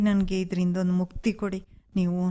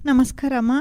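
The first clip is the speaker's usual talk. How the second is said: louder, urgent, breathless greeting; 5 semitones higher, 4 dB louder than usual. Acted. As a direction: greet her in a rush. Rushes back in urgency. (ಆತುರದ ಜೋರು ನಮಸ್ಕಾರ)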